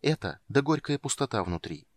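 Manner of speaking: ordinary delivery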